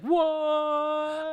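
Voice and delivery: high, far-off mock gasp of disbelief; shocked what. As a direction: voice the whole room reacting, shocked. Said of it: "in the whole room just goes like".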